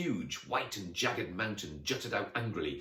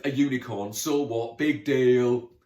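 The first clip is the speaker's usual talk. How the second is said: loud and scornful, a little higher; dismissive snort; 4 semitones higher, 11 dB louder, slower than usual. Acted. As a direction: shrug it off forcefully. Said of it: "snorted Dave".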